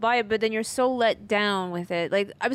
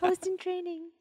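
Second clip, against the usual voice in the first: laughing; 8 semitones higher, 5 dB quieter than usual. Real, not acted. Teasing him with a shirt slogan.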